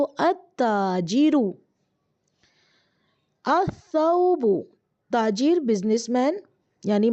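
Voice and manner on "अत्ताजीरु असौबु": अत्ताजीरु with the lam dropped, doubled t, said slowly; असौबु read slowly and carefully, the s doubled, no l sound; slow Arabic teaching demo; sun letter assimilation. Shown as a demonstration. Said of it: "कैसे पढ़ेंगे इसको?".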